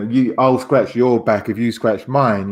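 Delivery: ordinary talk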